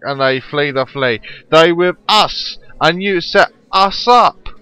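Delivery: put-on loud, raging old-fashioned thief; furious thief voice. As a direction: bellow it at the traitor, slow and heavy. Voicing an angry thief character.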